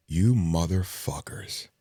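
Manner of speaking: grind it out in your head; slow bitter repeat; seething slow curse. Retold or imitated.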